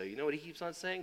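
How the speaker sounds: ordinary delivery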